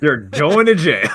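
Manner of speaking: loud, high mock sentencing; hammed-up loud threat. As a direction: call it out like a gleeful accuser. Mock threat in lawsuit skit.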